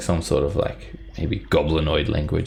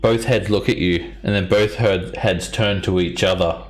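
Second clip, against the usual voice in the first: ordinary talk, nothing marked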